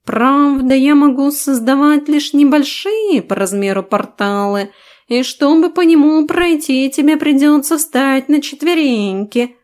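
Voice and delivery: high, self-satisfied talking cat; smug cat voice. Acted. Cat explains portal. (самодовольный кот, высоко)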